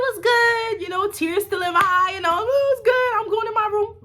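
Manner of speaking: very high, singsong mock cheeriness; faked happy greeting. Teen hiding tears from mom, faked cheer. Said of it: "I'm making sure I look happy".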